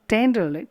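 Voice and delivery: TEN-derly, first syllable high and loud; stress on TEN. Shown as a demonstration. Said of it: "ten is a stressed".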